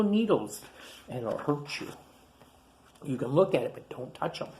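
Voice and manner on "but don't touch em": hushed warning. Real, not acted. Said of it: "warning to child listeners".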